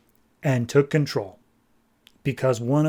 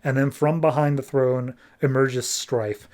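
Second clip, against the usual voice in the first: normal talking voice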